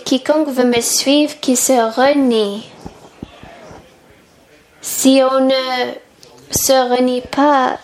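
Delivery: normal talking voice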